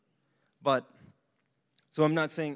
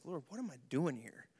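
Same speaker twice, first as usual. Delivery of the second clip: soft, lowered question to God; quiet prayer; 9 dB quieter than usual. Retold or imitated. Preacher reliving quiet prayer while shopping eggs, soft. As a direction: murmur it to the Lord.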